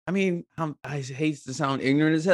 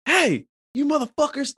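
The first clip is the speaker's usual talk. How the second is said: high-pitched indignant call; retold angry yell; 12 semitones higher than usual. Man calls out the kids in the car, indignant mock yell. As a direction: yell it as an angry driver retelling. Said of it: "I'm yelling at this little girl".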